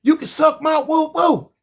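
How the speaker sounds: lightly voiced woman repeating her insult; quoting her again. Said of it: "a woman tell me".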